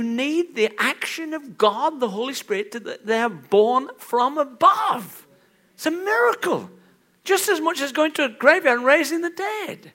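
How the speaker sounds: loud, high preaching voice; fervent preacher; preaching at full force. Acted. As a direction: drive the point home from the pulpit.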